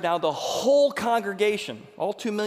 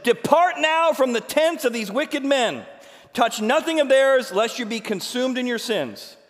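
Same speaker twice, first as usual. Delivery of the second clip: loud, higher, slow warning; urgent warning; 8 semitones higher, 6 dB louder than usual. Acted. Moses warning the congregation, loud and urgent. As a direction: warn them gravely and loudly.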